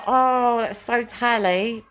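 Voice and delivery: tender. Retold or imitated. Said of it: "I was like".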